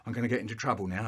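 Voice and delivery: fast, worried gabble; rushed panic. Real, not acted. Panicked aside.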